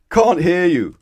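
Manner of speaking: loud, high booming reply. Acted. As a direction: boom it out. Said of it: "boomed the butcher".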